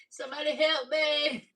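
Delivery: high-pitched playful wail; mock plea for help. Cook with fresh nails struggling, mock helpless plea.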